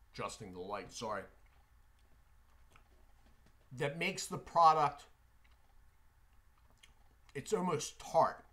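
chewing while talking; mouth full of gum. Real, not acted. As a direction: gum in cheek. Seen 0: lips pressed between phrases, gum tasting review, jaw busy throughout.

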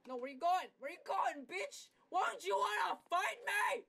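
high, loud taunts at a fleeing foe; taunting yell. Real, not acted. Chasing a player who runs off, a high taunting yell. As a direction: shout after him as he runs.

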